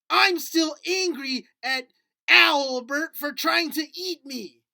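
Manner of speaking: little puppet nursing a grudge; grumpy puppet. Acted. Puppet complains about owl.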